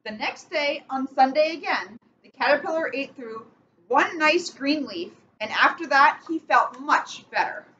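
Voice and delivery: slow storybook reading with steady rhythm; picture-book read-aloud, measured and clear; reading to children. Acted. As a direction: read it like bedtime story time for kids. Reads picture book to kids.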